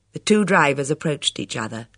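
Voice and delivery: usual speaking voice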